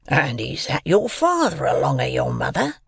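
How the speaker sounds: rough man's questioning voice; menacing convict. A narrator's reading. Convict asks the boy about family, menacing. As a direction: question the child as a threatening convict.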